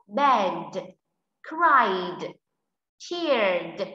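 "bed, cried, cheered", each ending in a voiced d; final D sound. Shown as a demonstration. Teacher shows class the ED ending, "bed, cried, cheered" with final d. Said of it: "đuôi E D là d".

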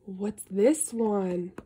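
ordinary delivery, nothing marked

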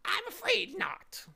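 very high, loud bird lament; sad bird. A narrator's reading. Bird replying sadly to Milo, high and mournful. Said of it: "he replied sadly".

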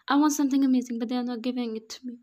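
loud, high whiny outburst; demanding loud complaint; bursting out. A narrator's reading. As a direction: burst out like a spoiled child. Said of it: "Wine busted out on him".

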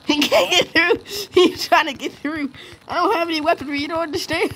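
high, squealed excitement; giddy panic. Real, not acted. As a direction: squeal it, close to laughing. Giddy panic as enemy attacks.

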